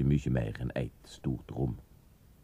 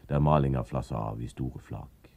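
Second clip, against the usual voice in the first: usual speaking voice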